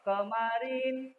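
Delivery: kemarin with KE stressed, drawn out slowly. Shown as a demonstration. Teacher drawing out kemarin slowly to show the stress on KE. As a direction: say kemarin slowly, leaning on the first syllable KE. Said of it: "ke-nya tekanannya lemah apa kuat".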